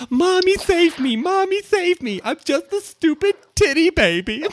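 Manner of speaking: very high, slow whimpering schoolkid voice; whiny child taunt. Retold or imitated. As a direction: mimic a crying kid begging for mommy. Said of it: "they used to call after you".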